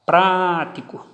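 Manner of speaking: Prático slow, PRÁ held long; stress on first syllable. Shown as a demonstration. Teacher showing the tonic syllable, Prático with a drawn-out PRÁ. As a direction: stretch PRÁ, then light ti-co in Prático. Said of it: "antepenúltima".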